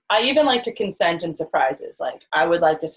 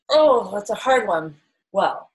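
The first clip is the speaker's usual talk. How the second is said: ordinary talk, nothing marked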